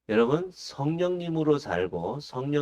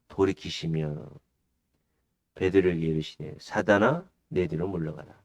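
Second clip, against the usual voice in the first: low-pitched, measured Bible reading; solemn low recitation of scripture; 7 semitones lower than usual. Acted. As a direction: read the verse solemnly, pitched down. Reads the scripture passage.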